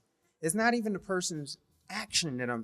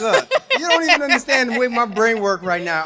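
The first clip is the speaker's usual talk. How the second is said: loud, raised voice full of laughter; gleeful excitement; 7 semitones higher, 17 dB louder than usual. Real, not acted. Husband plots to use wife's confession, laughing excitedly. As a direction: gush it out while laughing.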